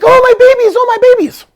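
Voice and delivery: imitating a mother's frantic cry; panicked mother. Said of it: "And a panic".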